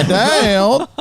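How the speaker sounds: put-on high, drawn-out Southern little kid; Southern accent. Dad imitating his three-year-old calling him, Southern accent. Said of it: "now has a Southern accent".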